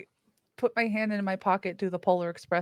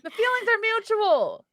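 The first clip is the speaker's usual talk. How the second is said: snippy; 12 semitones higher than usual. Acted. Snippy in-character retort.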